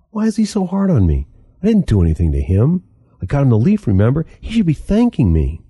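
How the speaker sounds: high, whiny hurt complaint; aggrieved youth. A narrator's reading. Youth complaining to Crow, whiny and high. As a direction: pitch the youth up, wounded and petulant.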